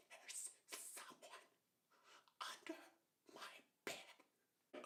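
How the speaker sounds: imitating a scared little sister whispering; tiny hushed voice, long pauses between words; quiet whisper. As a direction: whisper it haltingly, word by word. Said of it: "quietly whisper".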